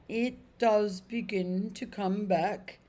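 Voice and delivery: slow, pondering horse voice; thoughtfully. Acted. Horse slowly recalling his old master, thoughtful. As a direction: let the memory dawn slowly. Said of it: "said the horse thoughtfully".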